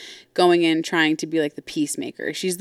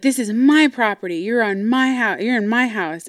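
higher-pitched retold insistence; angry insistence; 4 semitones higher, 4 dB louder than usual. Mom asserting dominance over daughter, acted angry insistence. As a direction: imitate a mom pulling rank.

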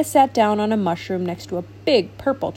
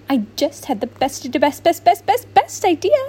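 excited; 5 semitones higher than usual. Acted. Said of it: "said Shimmer".

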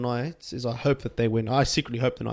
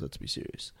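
dry whispered aside; whispering; 17 dB quieter than usual. Real, not acted. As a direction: mutter it under your breath. Dismissing the Tigers' chances.

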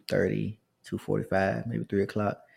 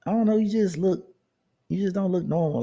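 a lightly voiced woman, pitched higher; imitating the older woman; 11 semitones higher than usual. Older woman coworker telling him he looks odd, light higher voice. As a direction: put on her higher voice, unsure of the words.

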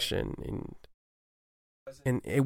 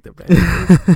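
imitating a Canadian accent, high and loud; mock Canadian twang on the plan is good; little Canadian accent, hammed up; 6 semitones higher, 14 dB louder than usual. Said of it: "like in this little Canadian accent".

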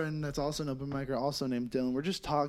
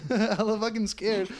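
usual speaking voice

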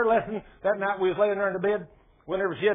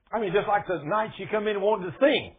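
ordinary delivery

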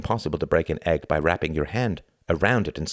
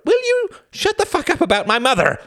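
very high, loud shout; 6 dB louder than usual. Acted. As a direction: shout it at her. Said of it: "shouted Reginald".

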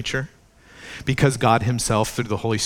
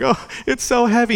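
whiny mock complaint; 12 semitones higher than usual. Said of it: "we're like".

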